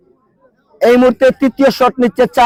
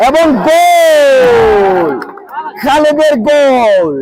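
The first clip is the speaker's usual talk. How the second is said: very long, high-pitched goal cry; excited goal call; 3 semitones higher, much slower than usual. Real, not acted. Commentator announcing the captain's penalty goal, elated drawn-out shout. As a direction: draw the vowel right out, very slowly.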